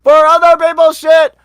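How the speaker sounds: high, loud indignant yelling; outraged shout. Real, not acted. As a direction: shout it, totally fed up. Ranting about subscription service.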